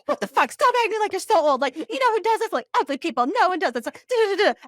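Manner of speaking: high, loud, fast outraged rant; mock rant. Friend imitating best friend's text, fast mock outrage. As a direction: fire it off like a scolding friend. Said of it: "It's like rapid response".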